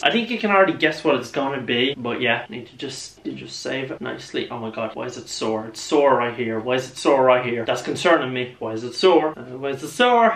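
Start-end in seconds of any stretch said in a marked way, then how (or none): 2.5-5.8 saving my voice, softly. Real, not acted. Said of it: "save my voice".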